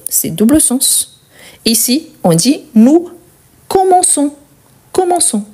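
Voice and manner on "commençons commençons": "commençons" with ç said as s; higher-pitched, careful "commençons", an s before the last syllable; soft S sound. Shown as a demonstration. Teacher models the cedilla for learners, soft S sound. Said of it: "pour avoir les sons de S".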